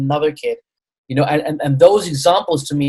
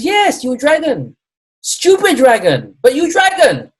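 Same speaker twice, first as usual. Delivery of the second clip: much higher, louder put-on Chinese accent; a teasing Chinese accent, pitched way up, stupid dragon slowed; bad Chinese accent; 10 semitones higher, 5 dB louder than usual. Acupuncturist scolding the proud dragon, high Chinese accent. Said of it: "a really bad version of his accent and his vo".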